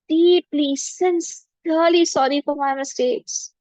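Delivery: calm, measured meditation voice; guided forgiveness meditation. Acted. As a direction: guide the listeners gently through forgiving.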